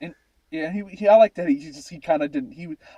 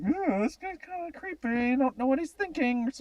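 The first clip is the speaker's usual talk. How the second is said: creeped-out viewer; 8 semitones higher, 4 dB quieter than usual. Retold or imitated. Viewer's imagined creeped-out thought.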